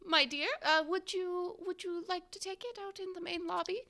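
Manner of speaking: high-pitched landlady, fussy and polite; strict but kindly landlady. Acted. Landlady telling her lodger of a phone call, high and fussy. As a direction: announce it in a high singsong landlady voice. Game master plays the landlady Doris.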